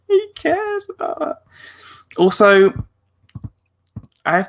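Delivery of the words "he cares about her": very high-pitched gush; touched squeal. Retold or imitated. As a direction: squeak it out, overcome with cuteness. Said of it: "And I was like".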